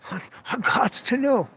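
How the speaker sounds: a hint of a sly persuader; lightly hammed-up coaxing; mock pleading. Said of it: "Master manipulator".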